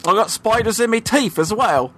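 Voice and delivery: silly Cockney joke voice; Cockney accent. Pretend. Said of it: "in a Cockney accent".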